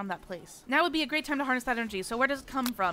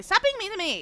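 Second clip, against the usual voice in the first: whiny protest; 8 semitones higher, 4 dB louder than usual. Real, not acted. Player protesting the game.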